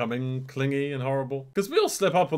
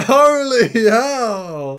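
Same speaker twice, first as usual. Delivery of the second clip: shocked shout; 10 semitones higher, 12 dB louder, much slower than usual. Real, not acted. Shocked by an explosion.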